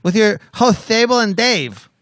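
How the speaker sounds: loud, high show host voice; announcer voice. Acted. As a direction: boom out the host names. Finishes announcer intro.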